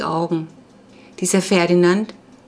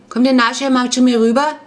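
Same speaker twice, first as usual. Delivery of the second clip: higher, louder question through food; mouth full; 6 semitones higher, 5 dB louder than usual. A narrator's reading. Clara invites the boys over, talking with mouth full. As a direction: ask as if chewing a mouthful. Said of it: "sagte sie mit vollem Mund".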